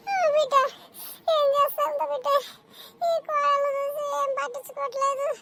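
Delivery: hammed-up tearful complaint; put-on crying.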